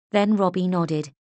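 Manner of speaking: ordinary delivery, nothing marked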